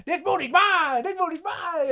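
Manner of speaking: high, strained character holler; hammed-up high-pitched chant; mock battle cry. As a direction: imitate a wild possessive cry. Acted prison brawl battle cry.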